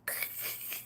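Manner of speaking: crackly imitation of breaking glass; imitated cracking noise. Demonstrating the sound heard that morning, imitated crackle.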